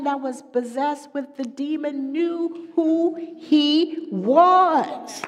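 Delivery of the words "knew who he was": slow, drawn-out higher, louder cry; preaching cry. Acted. Preacher crying out to the congregation. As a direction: stretch each word out in a rising cry.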